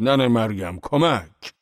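narrated scream, moderately acted; ear-splitting scream. A narrator's reading. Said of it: "با صدای گوش خراش فریاد".

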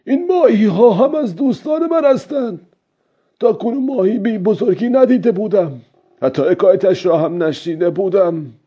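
put-on very high old-man voice, declaring aloud; old fisherman speaking aloud. A narrator's reading. Old man speaking of his fish friends, aloud in character. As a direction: say it out as the old fisherman, pitched right up. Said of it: "با صدای بلند گفت".

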